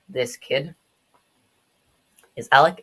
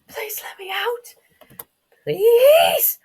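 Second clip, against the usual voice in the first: very high, squeaky plea; pleading child; 8 dB louder, much slower than usual. Acted. Trapped kid begging to be let out, high-pitched pleading. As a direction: stretch it into a high, strained beg.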